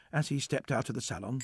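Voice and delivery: ordinary delivery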